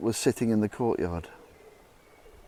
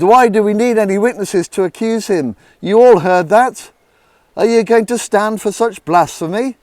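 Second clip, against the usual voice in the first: high, loud furious ranting; angry yell; 8 semitones higher, 9 dB louder than usual. Acted. Chief priest rousing the council, loud furious yell. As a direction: whip up the crowd in outrage. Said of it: "he yelled".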